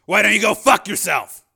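loud, high-pitched angry comeback; defiant retort. A narrator's reading. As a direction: throw it back at him forcefully. Defiant retort in armed standoff.

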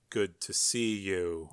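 slow, soft, low greeting; lightly voiced, unruffled agent; understated gray man. Gray man greets Joe over his fallen clone, understated.

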